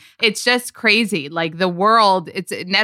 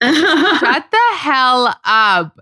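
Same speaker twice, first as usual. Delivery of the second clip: louder, slow, stunned disbelief; 5 semitones higher, 5 dB louder, much slower than usual. Real, not acted. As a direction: drag it out in disbelief. Shocked at her age.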